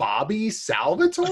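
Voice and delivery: high, slow disbelief, rising at the end; incredulous question. Retold or imitated. Friend repeats the unfamiliar nickname back, incredulous. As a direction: draw the name out as a baffled question. Retold baffled reaction to the name.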